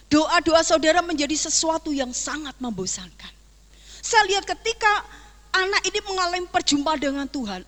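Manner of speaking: loud, high fervent sermon delivery; preaching at full force. Acted. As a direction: drive the message home to the congregation. Preaching climax to the congregation.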